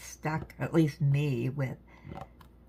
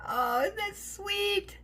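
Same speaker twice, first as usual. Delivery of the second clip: much higher, slow and sweet; cutesy voice; slower than usual. Real, not acted. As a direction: coo at it like something adorable. Admiring finished craft piece.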